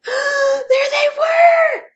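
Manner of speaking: gasp, then a high slow terrified shout; frightened cry. A narrator's reading. Narrator acting the bunny's fright at bedtime. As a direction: shriek it out in horror. Scare moment in story.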